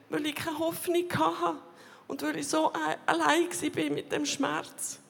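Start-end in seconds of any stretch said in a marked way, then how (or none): none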